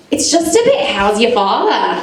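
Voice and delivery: light Australian twang on how's your father; a hint of an Australian accent, trying to sound local; Aussie slang. Comic telling her gynaecologist in a light Australian accent. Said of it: "I've been speaking differently".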